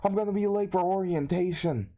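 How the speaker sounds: high-pitched, hurried panic; freaking out. Acted. Student freaking out at his watch, late for orientation, panicked. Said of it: "freaking out, saying".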